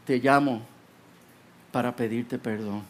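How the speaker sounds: low voice, sinking to very soft; halting, humbled father's confession; subdued apology. Acted. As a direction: let it fall away low and quiet. Father phones to ask forgiveness.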